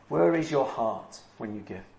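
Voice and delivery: soft repeat. Shown as a demonstration. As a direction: repeat it softly to let it sink in. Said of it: "So where is your heart when you give?".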